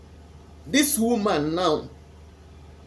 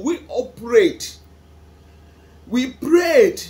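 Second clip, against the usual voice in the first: louder, slow, grieving cry of we prayed; pained raised voice at God; anguished outcry; 3 semitones higher, 7 dB louder, much slower than usual. Real, not acted. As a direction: let the hurt burst out loud.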